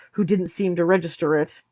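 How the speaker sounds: ordinary delivery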